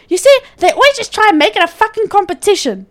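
high mock mother complaint; mocking mom. Mother complains to stepdad, high mocking voice. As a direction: pitch it up like a whining mother. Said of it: "she goes".

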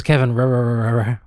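"R-, R-, R-" rolled on as a looping glitch; glitchy stuck surname. Retold or imitated. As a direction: stutter the R in "Kevin R-" like a jammed machine. Copying the assistant's garbled surname.

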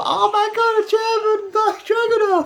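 high-pitched excited squeal; excited shriek. Real, not acted. Fan reacting to big suplex.

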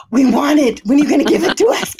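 gasp, then loud rushed demand; mock eager readers. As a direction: play greedy fans begging, speeding up. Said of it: "our readers will be like".

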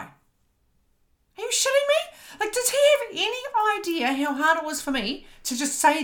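high squealed disbelief, stretched at first; incredulous outrage. Retold or imitated. Speaker reacting to coach asking for a deadline, incredulous squeal. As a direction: squeal it, appalled at the demand.

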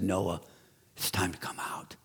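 whispered, tender and soft; hushed whisper. Acted. God gently calling Noah out, whispered. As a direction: breathe it like a gentle secret.

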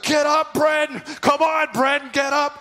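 loud, high shouted encouragement; dad yelling from the sideline. Retold or imitated. Dad yelling at his son on the field, loud and high. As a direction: bellow it across the field like a coach-dad. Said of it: "And he'd be like".

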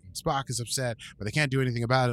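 normal talking voice

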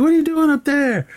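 high mock shout from the street; playful high-voiced impression of the movie character; squeaky high character voice. Acted movie quote, high voice.

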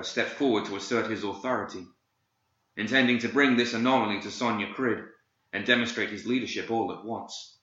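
plain reading voice

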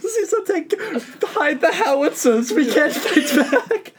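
high, slow mock-German military alarm; German accent. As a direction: play a German soldier giving up, close to laughing. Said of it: "I'm doing a German accent".

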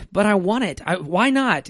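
put-on high, pleading child's whine; whining kid. Kid whining at dad for a candy bar, high whine. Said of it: "they continue to whine and grumble".

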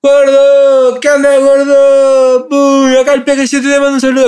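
loud high call across the prison yard; high, loud, drawn-out call. Retold or imitated. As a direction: shout up to a friend behind bars. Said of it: "le gritaba al gordo Leo".

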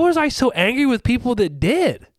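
high-pitched puzzled self-reproach; incredulous self-questioning. Retold or imitated. Retelling questioning his own anger at others, incredulous. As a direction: ask yourself in mild disbelief.

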